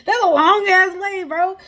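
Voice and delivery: loud incredulous complaint; exasperated outburst. Real, not acted. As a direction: groan it out in disbelief. Exasperated about chapter count.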